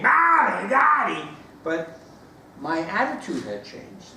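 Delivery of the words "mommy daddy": high, loud mock toddler cry; the kid on the plane still screaming for his parents; child screaming. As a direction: wail for mommy and daddy like a hurting child.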